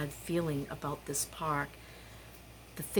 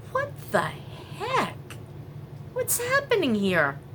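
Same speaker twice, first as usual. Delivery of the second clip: baffled; 12 semitones higher than usual. Real, not acted. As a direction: pitch leaps up on heck. Watching neighbors carry things out.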